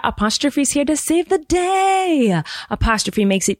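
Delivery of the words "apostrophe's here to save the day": slow, higher, heroic ad voice; lightly hammed rescue announcement; a hint of superhero announcer. Host reading sponsor ad, mock heroic announcer. Ad read hero line.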